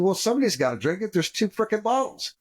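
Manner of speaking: high-pitched, hurried self-justification; mock protest. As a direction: argue back fast and high, retelling it comically. Defends taking the drink when scolded.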